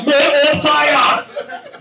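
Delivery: high, very slow Man on fire; Denzel title drawled. Retold or imitated. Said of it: "I go".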